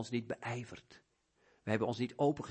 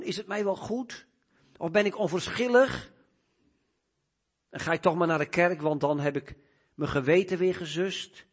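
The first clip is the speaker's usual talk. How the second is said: raised voice, building louder through the questions; searching preaching; 7 semitones higher, 8 dB louder than usual. Real, not acted. Preacher examining the heart before God, searching and increasingly forceful. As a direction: confront the conscience with mounting force.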